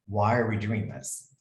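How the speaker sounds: normal talking voice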